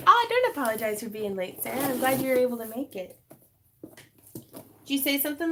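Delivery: ordinary talk, nothing marked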